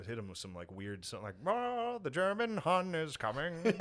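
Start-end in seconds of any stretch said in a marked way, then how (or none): 1.5-3.6 high, drawn-out mock alarm; hammed-up wartime radio scare voice; radio propaganda. Old radio warning the public about the Germans, mock alarm. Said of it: "hit 'em with some radio".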